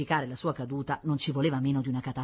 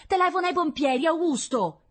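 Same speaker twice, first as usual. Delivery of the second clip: high, loud call down to the street; woman calling down from her balcony; shouting from a balcony; 4 dB louder than usual. A narrator's reading. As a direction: yell it down to Augusto. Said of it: "gli gridò dal balcone".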